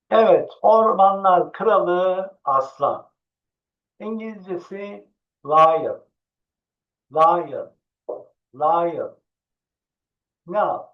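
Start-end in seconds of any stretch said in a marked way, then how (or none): 5.5-9.2 lion, slow and clear like a teacher; lion spoken for kids to copy; model pronunciation. Shown as a demonstration. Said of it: "repeat after me".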